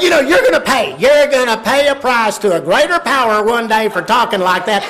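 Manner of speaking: loud, high pious warning; scolding mother. Retold or imitated. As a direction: scold the husband with righteous force. Mother warns swearing husband.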